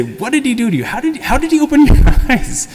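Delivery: high, laughing parody of a nagging questioner; mocking Pharisees. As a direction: send up the Pharisees' baffled interrogation. Pharisees questioning the healed man.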